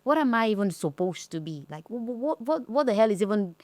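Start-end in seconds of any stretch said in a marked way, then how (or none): none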